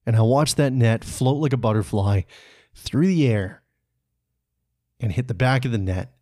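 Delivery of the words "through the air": usual speaking voice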